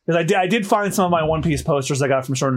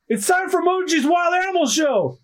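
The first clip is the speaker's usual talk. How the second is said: very high crowd-hyping call; high-pitched ringmaster shout; excited announcement; 12 semitones higher than usual. Acted. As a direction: hype the show to a cheering crew. Crew hails the lion tamer's show.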